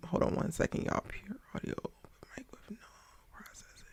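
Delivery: hushed unvoiced aside off mic; whispering aside. Real, not acted.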